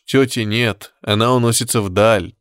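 ordinary delivery, nothing marked

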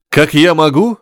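high, snapping husband; losing his temper. A narrator's reading. Husband loses control with his wife, snapping angrily. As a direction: snap back at the accusation. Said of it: "сорвался и Петров".

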